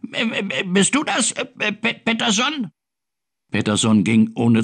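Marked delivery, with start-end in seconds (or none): 0.1-2.7 high, halting hen voice; clucky, hesitant high-pitched question; stammering hen. A narrator's reading. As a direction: stutter it like a flustered hen.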